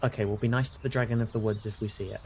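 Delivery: ordinary talk, nothing marked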